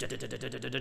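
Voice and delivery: low, quick, even da-da-da run; rapid drum syllables. Shown as a demonstration. Teacher showing a drum part, quick even vocalised rhythm. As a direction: patter the roll evenly on da. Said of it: "you have accents that you need to show".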